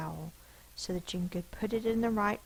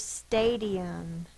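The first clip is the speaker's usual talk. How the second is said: slow word announcement; 3 dB louder than usual. Shown as a demonstration. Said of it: "teacher announces word slowly".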